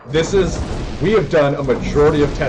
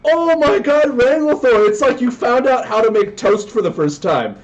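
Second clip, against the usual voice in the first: excited shout; 10 semitones higher than usual. Real, not acted. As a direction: still buzzing from the play. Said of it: "I'm super excited".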